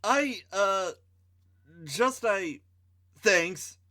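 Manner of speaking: shy, halting thanks with long pauses; stammering. Acted. Wendy thanking the hero for the baton, shy stammer. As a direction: stumble over the words, embarrassed. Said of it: "she stammered".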